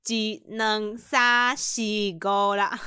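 very slow, put-on old market uncle counting bills. Showing the class how a Taiwanese market uncle counts cash, slow put-on voice. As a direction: drag each count out like a stallholder. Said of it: "我就很像那种菜市场阿伯啊".

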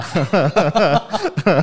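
a long, high burst of laughter, falling away at the end; loud amused laugh. Real, not acted. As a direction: laugh it off, caught off guard. Laughs at twelve years primary. (被逗得放声大笑)

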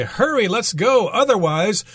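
urgent example quote. Pretend. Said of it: "You could say".

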